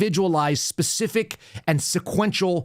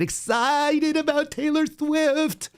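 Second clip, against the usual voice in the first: put-on much higher, sneering valley-girl upspeak; high mocking upspeak; mocking up-talk; 13 semitones higher, much slower than usual. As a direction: lampoon a corporate woman's up-talk. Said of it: "with the same sort of up-talk bullshit".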